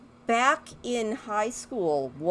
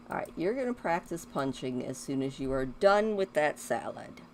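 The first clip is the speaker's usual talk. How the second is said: usual speaking voice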